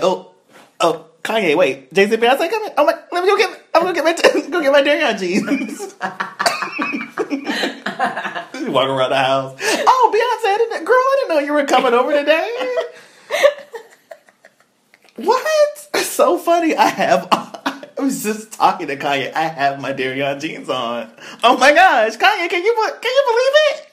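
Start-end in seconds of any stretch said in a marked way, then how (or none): 0.0-5.9 very high, loud, giddy voice; Kim Kardashian impression. Voicing Kim in a story. 9.6-12.8 very high, loud, giddy voice; Kim Kardashian impression. Voicing Kim greeting [name]. 15.1-23.8 very high, loud, giddy voice; Kim Kardashian impression. Voicing Kim, hosting guests.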